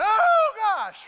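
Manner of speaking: hammed-up shriek of snake panic; panicked scream.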